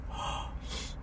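soft, breath-only gasp; stunned gasp. Retold or imitated. Fan re-enacting his reaction to the kid line, stunned gasp. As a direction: suck in air in mock shock.

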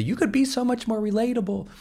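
high mocking critic voice; imitating critics. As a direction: sing it up high like a know-it-all. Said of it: "Everyone tells me on social media".

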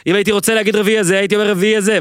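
a touch of irritation, quick; lightly imitating an annoyed man, snapping back; mock annoyed retort. Retelling the Seinfeld argument, mock annoyed and quick. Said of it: "ואז סיד מתעצבן".

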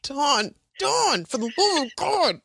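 high, pleading exasperation; comic fed-up appeal; exasperated. Retold or imitated. Acting Buffy exasperated with Dawn.

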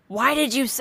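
ordinary delivery

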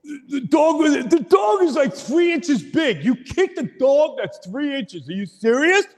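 high, loud disbelieving yell; high mock outrage, hammed up; incredulous shout. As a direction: shout it like you can't believe what he did. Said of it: "He's like".

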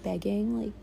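usual speaking voice

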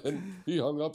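giggling; laughing. Real, not acted. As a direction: laugh while telling it.

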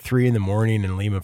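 usual speaking voice

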